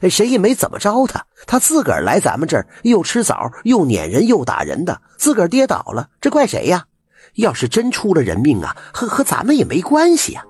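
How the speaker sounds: high, rapid village auntie lecturing the crowd; a village aunt shrugging off blame to everyone; busybody auntie. A narrator's reading. (吴婶儿对众人撇清责任，声调高快)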